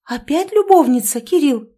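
high, loud ringing question. A narrator's reading. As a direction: chime in brightly and loud. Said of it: "Лиза звонко спросила".

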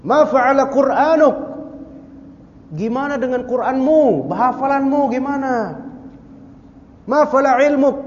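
high, sustained shouted questions; acted calling out. Comrades questioning the man at the fort, calling out. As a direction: call each question across a distance.

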